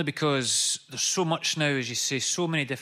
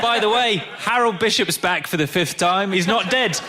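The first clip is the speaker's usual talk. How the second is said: put-on loud chatty Essex accent; lightly put-on Essex gossip voice; 5 semitones higher, 5 dB louder than usual. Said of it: "compliment you on your Essex accent".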